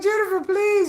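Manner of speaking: mock begging plea. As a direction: beg her sweetly, pitched high. Begging Jennifer.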